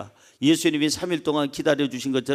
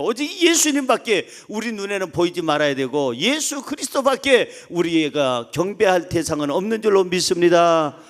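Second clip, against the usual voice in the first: usual speaking voice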